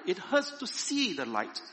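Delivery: high-pitched complaint; pained cry. A narrator's reading. Cave people hurt by the sudden firelight, pained cry. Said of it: "they cried".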